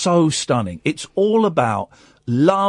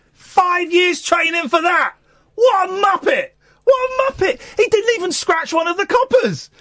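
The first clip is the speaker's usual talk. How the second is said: high squeaky mocking voice, loud; shrill mock outrage; mocking squeal; 4 dB louder than usual. Real, not acted. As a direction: squeal in disbelief at the muppet. Mocking the knife man, shrieking.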